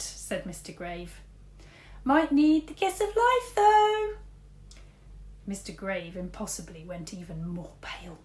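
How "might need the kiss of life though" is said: high voice. A narrator's reading. Teacher jokes aside.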